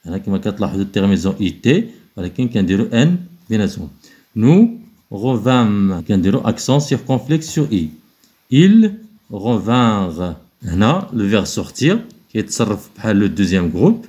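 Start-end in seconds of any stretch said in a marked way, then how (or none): 4.4-6.0 dictation. Shown as a demonstration. Dictating conjugation to class. 8.5-10.4 dictation. Shown as a demonstration. Dictating conjugation to class.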